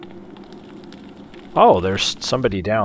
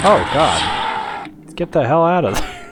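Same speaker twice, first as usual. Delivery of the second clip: startled; 8 semitones higher, 4 dB louder, slower than usual. Real, not acted. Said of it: "That actually startled me".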